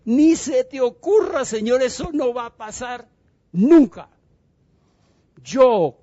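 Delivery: high, loud and emphatic, building on nunca; Pedro protesting. Acted. Pedro objecting to Jesus, loud and desperate. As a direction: burst out in passionate refusal.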